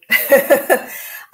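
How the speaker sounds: brief, loud chuckle in quick bursts; short laugh. Real, not acted. Painter laughs after promising viewers to speak up, short laugh. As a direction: laugh lightly at yourself, a few quick pulses.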